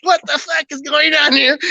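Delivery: high-pitched mock panic; freaking-out friend. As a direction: imitate a panicking friend, voice shooting way up. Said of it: "One of my friends is freaking out".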